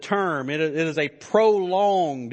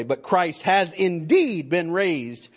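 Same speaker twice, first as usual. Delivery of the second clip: slow, with INDEED lifted and stressed; emphasis on INDEED. Shown as a demonstration. Preacher showing how a translation captures the emphasis, stressing INDEED. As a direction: lean hard on the word INDEED. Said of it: "captures the emphasis this way".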